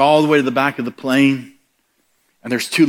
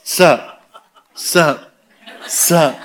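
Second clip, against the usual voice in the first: cocky sup; 3 semitones higher, much slower than usual. Retold or imitated. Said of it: "like I was somebody".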